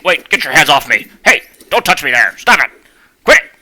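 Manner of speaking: high, loud panicked protest; mock panic. Voicing Link being grabbed and plugged in, loud mock panic. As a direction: squeal to get their hands off.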